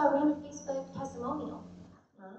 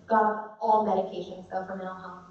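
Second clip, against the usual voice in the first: ordinary talk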